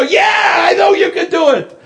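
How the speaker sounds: high, fast shouted cheer; eager crowd shouting encouragement; crowd yelling. Retold or imitated. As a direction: bellow it like the whole crowd believes. Crowd cheering the tightrope walker.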